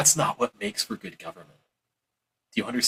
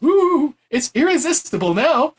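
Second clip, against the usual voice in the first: high, loud mock whoop; mock excitement; 12 semitones higher, 6 dB louder, slower than usual. Mocking buyers won over by whipped cream, hammed-up excitement. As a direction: gush with put-on delight. Mock delight at dressed-up propaganda.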